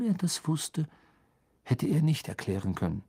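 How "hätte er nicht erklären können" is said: ordinary delivery, nothing marked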